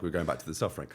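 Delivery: ordinary talk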